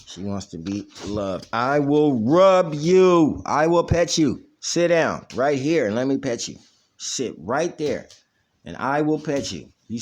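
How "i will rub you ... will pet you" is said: sweet-talking the dog; cooing at the dog. Real, not acted. Owner cooing to hyper dog.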